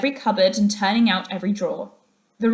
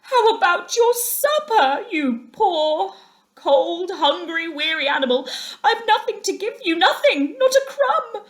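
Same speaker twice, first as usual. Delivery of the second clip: high, louder whining despair; miserable Mole; 10 semitones higher, 5 dB louder than usual. A narrator's reading. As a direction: fret over the empty larder.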